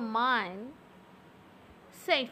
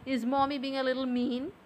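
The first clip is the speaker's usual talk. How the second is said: normal talking voice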